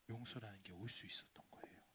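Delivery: hushed whispered sorrow. Acted. Preacher on God's heartbreak, whispered. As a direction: say it softly, breath only.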